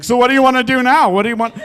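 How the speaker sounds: high, loud, fast eager questions; eager puppy. Retold or imitated. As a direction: chase her with words like a keen puppy. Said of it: "following her around like a puppy".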